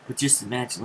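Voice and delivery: ordinary talk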